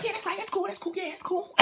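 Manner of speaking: high, soft eager agreement; steward agreeing. Retold or imitated. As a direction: play the steward, high and breezy. Flirty steward voiced high.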